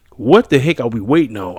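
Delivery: ordinary delivery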